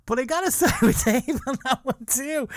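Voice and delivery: high, laughter breaking into the words; laughing while speaking. Real, not acted. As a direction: giggle your way through it. Teasing friend while laughing.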